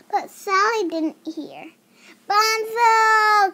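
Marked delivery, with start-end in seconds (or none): 2.3-3.5 loud, high call for Bonzo. Acted. Sally calling out loud for Bonzo. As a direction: call across the yard for the lost cousin. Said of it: "called Sally".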